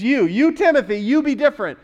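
"You" hit high and loud; stress on YOU. Shown as a demonstration. Preacher voicing the charge to Timothy, high stress on "you". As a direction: punch each "you" emphatically.

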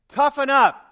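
high, loud, punchy order; loud challenge. Acted. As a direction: bark it at the congregation. Preacher exhortation.

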